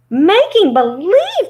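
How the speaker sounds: high, loud, drawn-out disbelief; shocked cry. Acted. Dorothy echoes the little man's confession, crying out shocked. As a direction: cry it out slowly, high and incredulous. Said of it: "cried Dorothy".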